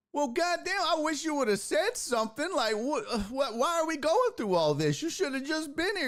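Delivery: high-pitched acted telling-off; exasperated scolding. Constable to the late tow truck driver, exasperated. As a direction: play a fed-up officer chewing him out.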